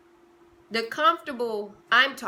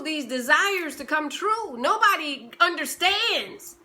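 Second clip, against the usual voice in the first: rising preaching; 5 semitones higher, 6 dB louder than usual. Acted. Preaching rises to full force.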